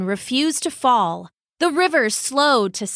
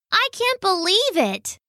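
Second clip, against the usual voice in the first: very high scoffing bird voice; scoffing magpie; 10 semitones higher than usual. Acted. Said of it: "said the magpie".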